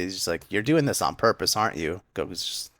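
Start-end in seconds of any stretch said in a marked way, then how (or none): none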